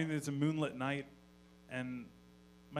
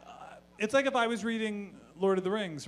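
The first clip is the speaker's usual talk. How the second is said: ordinary talk, nothing marked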